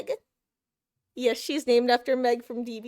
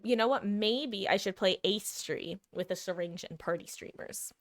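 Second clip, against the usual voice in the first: ordinary talk, nothing marked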